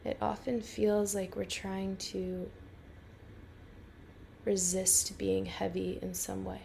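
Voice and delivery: calm, unhurried guiding voice with long pauses; guided meditation. Real, not acted. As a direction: lead a listener gently into rest, phrase by phrase.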